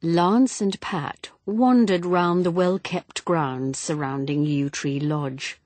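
plain reading voice

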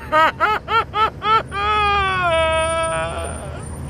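high, shouting cackle mixed with crying; crazed laugh-sob. Retold or imitated. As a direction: laugh and wail at once, unhinged. After the reliving.

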